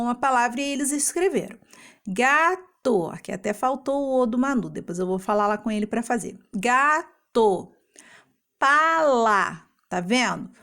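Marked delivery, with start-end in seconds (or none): 2.1-3.1 "Gato" split as ga... to; teacher sounding out "Gato" in syllables. Shown as a demonstration. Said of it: "eles abrem a boca duas vezes". 6.5-7.7 "Gato" in two drawn-out beats; syllable by syllable. Shown as a demonstration. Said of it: "eles abrem a boca duas vezes". 8.6-9.6 "Pala" split as pa... la; teacher sounding out "Pala" in syllables. Shown as a demonstration. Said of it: "Duas sílabas".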